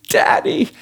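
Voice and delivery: put-on high, squeaky little-girl "daddy"; emotional child's cry. Granddaughter calling to grandpa in the pool, emotional and high. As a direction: mimic an overexcited grandchild, pitch leaping up. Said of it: "there was this emotional".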